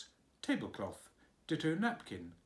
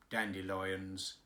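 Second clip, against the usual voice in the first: low, slow stolid gardener; a slow, low countryman; 6 semitones lower, 3 dB quieter, slower than usual. A narrator's reading. Gardener answering the nurse, low and stolid.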